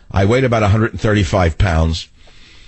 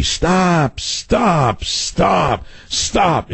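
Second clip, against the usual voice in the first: high, urgent cries of stop; retold scream; 9 semitones higher, much slower than usual. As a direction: imitate an inner voice screaming. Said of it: "an inner voice scream at me".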